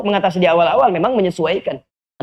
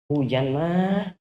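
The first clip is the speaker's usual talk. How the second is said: lazy excuse; 4 semitones lower, 7 dB quieter, much slower than usual. Acted. Lazy husband excusing himself.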